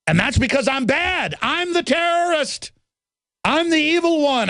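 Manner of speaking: high, hammed-up sarcasm; sarcastic mock villain. Host mocking critics who call him evil, sarcastic. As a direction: pretend to be the evil one, mockingly.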